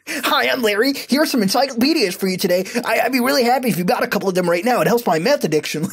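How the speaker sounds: high, fast, pushy sales pitch; hyperactive telemarketer. Acted. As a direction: sell it like a wired, overeager telemarketer. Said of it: "pretend to be a hyperactive telemarketer".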